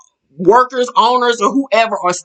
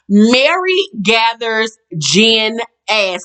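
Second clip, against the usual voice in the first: ordinary delivery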